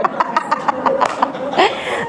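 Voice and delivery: soft unvoiced giggle; soft real laughter; breathy laugh. Real, not acted. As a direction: laugh quietly at the grandson's line. Laughing at the story.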